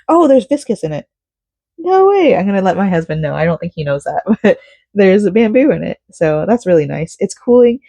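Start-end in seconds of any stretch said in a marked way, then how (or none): 0.1-2.3 surprised. Real, not acted. Discovering fabric content on label.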